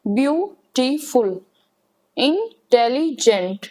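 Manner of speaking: Beautiful drawn out, each syllable separate; beautiful spoken slowly, one syllable at a time. Shown as a demonstration. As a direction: pronounce beautiful slowly to show the breaks. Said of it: "बीच में word में interruption आ रही है".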